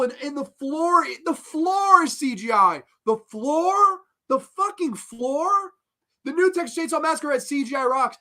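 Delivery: high-pitched exasperated disbelief; real, worked-up outrage at absurd nonsense; losing it. Real, not acted. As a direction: rant incredulously, pitch way up. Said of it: "I'm losing my shit".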